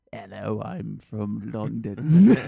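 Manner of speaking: low, slow attempt at a London accent; badly faked English accent, drawn out. Shown as a demonstration. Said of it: "if it was just me".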